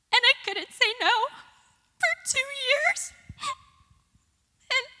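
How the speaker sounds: tearful and hushed, voice breaking high; fighting back real tears, barely getting words out; crying. Real, not acted. Speaker telling audience of her abuse, through real tears. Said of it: "crying on a stage".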